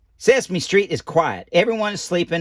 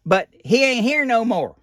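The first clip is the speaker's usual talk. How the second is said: folksy misreading; 7 semitones higher than usual. Retold or imitated. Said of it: "you know me, I'm Billy Bob".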